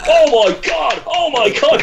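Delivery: loud, high mock gasp of "Oh my God"; amazed audience outcry. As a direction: imitate a crowd erupting in disbelief. Whole audience reacting to his comeback.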